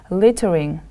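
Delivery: Littering with the stress on LIT; teacher's LIT-tering, first syllable leading. Shown as a demonstration. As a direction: model Littering for learners, leaning on the first syllable. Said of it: "The stress is on the first syllable".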